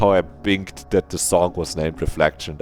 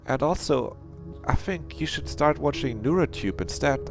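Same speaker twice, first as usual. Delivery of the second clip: high-voiced game character; 9 semitones higher than usual.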